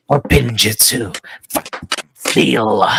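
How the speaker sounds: low hissing villain voice; imitating Orochimaru's voice; Orochimaru impression. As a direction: drop low and creepy like the villain. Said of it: "especially when he says".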